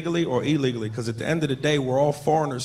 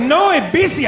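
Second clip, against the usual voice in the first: mock Mexican accent; 11 semitones higher, 5 dB louder than usual. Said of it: "He said".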